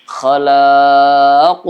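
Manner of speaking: khalaq ended with a loud strong bounce on the final q; strong qalqalah. Shown as a demonstration. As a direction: hit the last q of khalaq hard and let it echo. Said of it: "Kalau yang kubra". (khalaq dengan pantulan qaf yang kuat dan keras)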